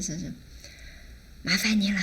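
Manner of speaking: soft, slow plea; gentle begging for a favor. Shown as a demonstration. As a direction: plead quietly, drawing the words out. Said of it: "like you're begging somebody to do a favor".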